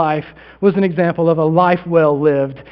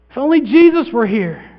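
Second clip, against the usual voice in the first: higher, raised-voice plea; higher, impassioned wishing; pleading lament; 5 semitones higher than usual. Acted. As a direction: cry it out as a longing appeal. Repeats the sisters' lament.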